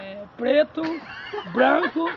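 usual speaking voice

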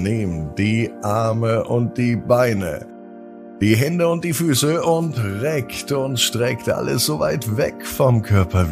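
ordinary delivery, nothing marked

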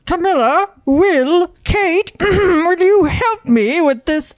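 high, slow regal plea; a king straining at a squeaky door. Pretend. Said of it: "a king fixing a squeaky door".

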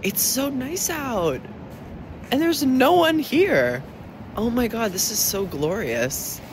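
high slow delight. Real, not acted. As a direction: marvel at the empty street. Delighted on morning walk.

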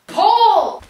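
loud shrieking call; scream. Real, not acted. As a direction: scream the name. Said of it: "You scream like that again".

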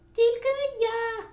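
very high, loud piercing squeak; squeaking mouse. A narrator's reading. Second mouse refuses to knead dough, squeaking. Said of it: "запищав Верть".

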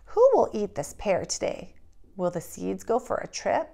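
ordinary delivery

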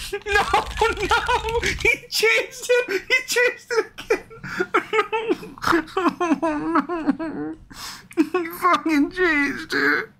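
high, drawn-out laughing sob; wailing despair. Real, not acted. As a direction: wail helplessly, half laughing, half crying. Game changed his choice.

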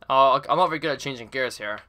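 normal talking voice

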